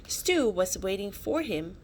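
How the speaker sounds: plain reading voice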